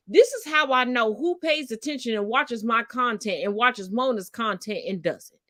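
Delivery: normal talking voice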